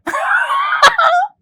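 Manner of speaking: high-pitched burst of laughter. Real, not acted. Laughs at bringing a mango. (ज़ोर की ऊँची हँसी)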